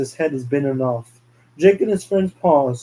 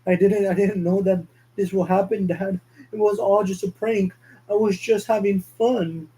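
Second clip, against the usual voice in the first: high, pleading, panicked son; guilty pleading; 6 semitones higher than usual. Acted. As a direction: beg forgiveness in a panic.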